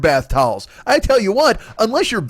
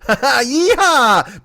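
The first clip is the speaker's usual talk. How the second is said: cowboy whoop; 4 semitones higher than usual. Pretend.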